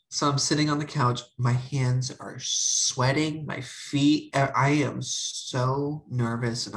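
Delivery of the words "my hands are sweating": ordinary talk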